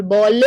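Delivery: "ball" with a loud extra uh after the l; intrusive schwa. Shown as a demonstration. Showing a schwa added to a word, intrusive schwa. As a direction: say "ball" as two syllables, ball-uh. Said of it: "Intrusive schwa".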